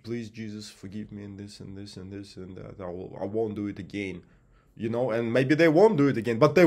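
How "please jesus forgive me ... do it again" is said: hushed, mumbled mock prayer, much softer; mocking fake prayer. Mocking fake Christians begging forgiveness, hushed mumbled prayer. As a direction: parody a hollow rote plea to Jesus. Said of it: "They just".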